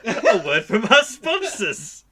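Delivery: slow, loud, laughing announcer. Acted. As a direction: announce the break like a host. Introducing the ad break.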